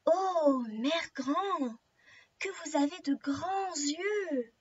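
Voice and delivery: slow, drawn-out storybook wonder; astonished girl. Acted. Girl notices Grandmother's odd eyes, slow swooping astonishment. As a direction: marvel slowly, stretching each phrase. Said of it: "étonnée".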